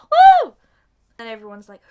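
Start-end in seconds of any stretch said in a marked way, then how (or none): 0.1-0.5 very high, loud woo; one piercingly high cheer; celebratory whoop. Acted. Cheer after the magic works.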